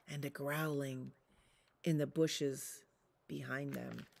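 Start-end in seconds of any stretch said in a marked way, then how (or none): none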